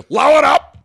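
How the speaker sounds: high, loud hollered catchphrase; imitated fan shout. Fans running up to the host, imitated shout. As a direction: yell it like an overexcited fan. Acting out fans greeting him.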